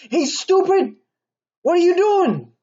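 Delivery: very high, loud insulting shout; rude yell. Retold or imitated. Stranger rudely yelling at someone on the deadly path, angry shout. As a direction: yell at them like they're an idiot. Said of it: "they're a little bit rude".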